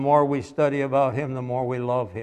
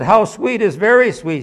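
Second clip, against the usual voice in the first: higher voice for the curious man; the man asking; 7 semitones higher, 7 dB louder than usual. Retold or imitated. Preacher voicing a man asking a boy. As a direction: voice the stranger questioning the boy.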